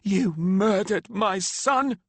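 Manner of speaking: high, slow, loud snarl; grieving mother snarling at the accused. A narrator's reading. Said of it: "she snarled".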